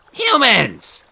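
loud, high sharp snap; snapped. A narrator's reading. Lazuli snaps at the dawdling brothers, loud and sharp. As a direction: bite the word off at them. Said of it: "she snapped".

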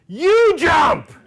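very high, loud screamed order; imitated all-out yell; screaming. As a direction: scream it at a teammate. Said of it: "so he's screaming at Tony".

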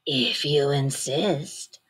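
low slow menacing buzz; yellow jacket voice. A narrator's reading. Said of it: "said the yellow jacket".

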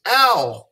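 sharp high "Ow" like a sudden pinch. Shown as a demonstration. Teacher shows the ow sound, yelping like she got pinched. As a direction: yelp "Ow" as if someone pinched you. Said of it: "like somebody pinched you".

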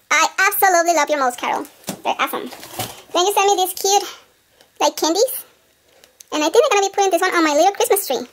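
ordinary delivery

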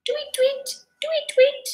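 very high, piping tweets; chirping birds. A narrator's reading. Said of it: "went the birds".

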